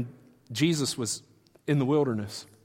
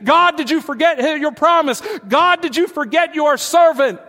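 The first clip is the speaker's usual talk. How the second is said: high, loud pleading lament; crying out to God; 12 semitones higher, 9 dB louder than usual. Retold or imitated. Imagined David crying out to God, loud plea. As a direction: wail it up at the sky. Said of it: "crying out to the sky saying".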